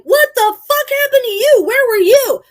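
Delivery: very high, loud furious demand; angry yelling. Retold or imitated. Driver to unresponsive TC after the escape, yelling furiously. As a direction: chew them out at the top of the voice.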